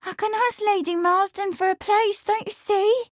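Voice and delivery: high, eager, tumbling plea; pleading. A narrator's reading. Amelia pushes Lawrence to marry, pleading. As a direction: press the case urgently, pitched well up.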